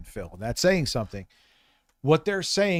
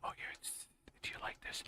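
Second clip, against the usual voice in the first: breathy whispered imitation of an ASMR video; mock ASMR whisper; 20 dB quieter, faster than usual. Streamer mocking the ASMR woman Harmon watched, whispered. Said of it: "some woman going".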